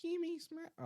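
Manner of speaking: very high, squeezed-up character voice; failed impression. Shown as a demonstration. Streamer trying another impression before giving up, high character voice. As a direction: attempt a tiny high character voice. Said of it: "no impressions from me".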